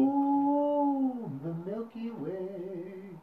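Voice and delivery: very high, drawn-out coo; slow, high-pitched mock ecstasy; mock swooning. Mock pleasure in science innuendo.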